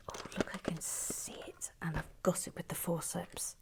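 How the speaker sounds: near-whisper, very soft; hushed. Real, not acted. Host spotting the piece for the forceps, hushed. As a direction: whisper it in careful concentration. Retrieving a small piece carefully.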